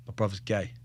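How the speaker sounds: retold confession, low and soft; hushed confession. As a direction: break the news quietly, in a low voice. First, hesitant confession to friend.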